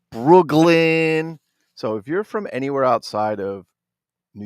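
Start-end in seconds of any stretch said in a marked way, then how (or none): 0.1-1.4 long, loud, higher Brooklyn with a hard g; heavy Brooklyn accent. Acted. Toy collector chanting his borough's name to viewers, heavy Brooklyn accent. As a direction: bellow it slowly in a thick Brooklyn accent. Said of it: "you got that heavy accent".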